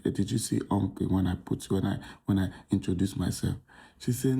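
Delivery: usual speaking voice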